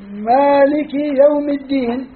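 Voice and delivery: مالك said slowly with a long first a, maalik; reading with the alif. Shown as a demonstration. Teacher reciting the reading مالك for students, slowly with a long a. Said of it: "مالك بالألف".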